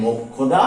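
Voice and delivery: মোক্ষদা low and soft as mok-khoda; k-kh pronunciation. Shown as a demonstration. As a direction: say মোক্ষদা softly with a doubled k-kh. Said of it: "হয়ে যাবে কিন্তু ক খ".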